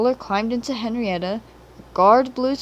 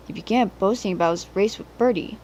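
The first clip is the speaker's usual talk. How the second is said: ordinary delivery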